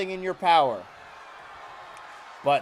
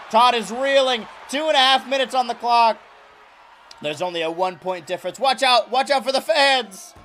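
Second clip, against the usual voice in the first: shouting commentator; 6 semitones higher, 6 dB louder than usual. Acted. Commentator shouts as the match heats up.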